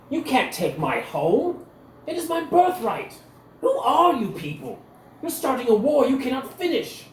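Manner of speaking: a pampered lord sputtering at the insult; outraged noble. Acted. Captured noble to Cecil, high indignant outrage.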